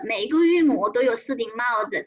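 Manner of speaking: ordinary talk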